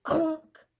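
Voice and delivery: high, loud sound-effect clunk. A narrator's reading. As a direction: bang it out like a thud. Pen falls off desk.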